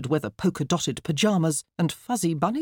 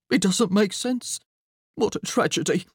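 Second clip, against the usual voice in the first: snuffling; 4 semitones higher than usual. A narrator's reading. Father mourning his plant, snuffling. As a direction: lament it through the sniffles. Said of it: "Mister McCrumpet snuffled".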